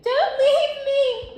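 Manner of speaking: loud, slow, high cry; desperate plea. Acted. Girl screaming after her father in a nightmare. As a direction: call after him as he leaves.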